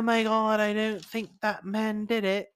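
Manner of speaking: a Portuguese judge who slips into British; accidental British accent. Retold or imitated. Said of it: "He's British now?".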